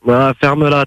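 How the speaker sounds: ordinary talk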